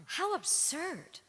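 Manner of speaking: slow, scornful "how absurd"; drawn-out disdain at the idea; a slow scoff of disbelief; scornful thought. Acted. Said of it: "He thought".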